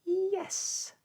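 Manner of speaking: high excited yes; a bright, high, eager cry; children shouting. A narrator's reading. Jane and Michael answering Miss Tartlet, high and excited. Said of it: "shouted Jane and Michael".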